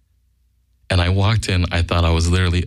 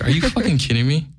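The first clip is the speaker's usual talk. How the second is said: higher-pitched mock disbelief; incredulous buddy; 8 semitones higher than usual. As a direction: imitate a friend's incredulous reaction. Retelling buddy's incredulous reaction.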